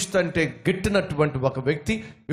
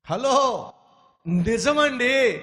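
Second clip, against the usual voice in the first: mock surprised hello; 8 semitones higher, much slower than usual. Preacher mocks an audience claim.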